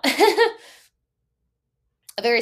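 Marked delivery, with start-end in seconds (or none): 0.0-0.5 fast, then a high laugh through emotion; emotional laugh. Real, not acted. Coach telling her story, laughing through emotion. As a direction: laugh it off while choking up. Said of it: "I'm getting emotional now".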